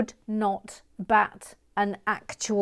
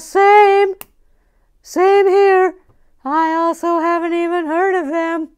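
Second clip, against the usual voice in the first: high, loud put-on voice; squeaky voice; 8 semitones higher, 10 dB louder than usual. Reading a fan's comment aloud.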